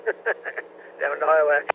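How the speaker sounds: ordinary talk, nothing marked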